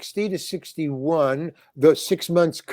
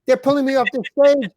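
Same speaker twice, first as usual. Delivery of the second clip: exasperated protest; 6 semitones higher than usual. Retold or imitated. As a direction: vent it like a wronged kid pleading his innocence. Complaining to manager about being arrested.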